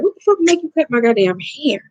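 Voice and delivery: high-pitched indignant outburst; pissed off. Retold or imitated. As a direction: replay your outrage at the haircut, pitch jumping up. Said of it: "I was so pissed".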